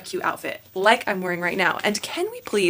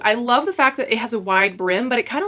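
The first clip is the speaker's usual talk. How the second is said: ordinary talk, nothing marked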